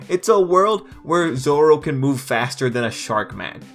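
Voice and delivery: usual speaking voice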